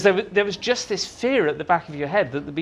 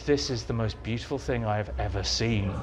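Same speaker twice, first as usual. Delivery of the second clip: hushed awe; 7 semitones lower, 6 dB quieter than usual. Retold or imitated. Awe at seeing the Dalek.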